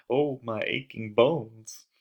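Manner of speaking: mock complaint about aching bones; mock whining. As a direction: groan it like a creaky old man. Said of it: "without whining".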